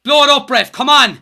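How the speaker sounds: loud, urgent plea; pleading with the referee. Real, not acted. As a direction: shout at the ref to finish. Fan pleading for final whistle.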